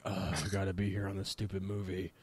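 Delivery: low, soft, put-upon sulky actor; mock grumble. Actor imagined grumbling about the cheap film, low mock sulk. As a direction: grumble like a bored actor stuck on set.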